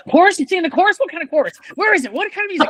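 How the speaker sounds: high-pitched burst of eager questions; excited questioning. Retold or imitated. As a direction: pounce on every question, voice leaping up. Acted eager reply to coworker's invitation.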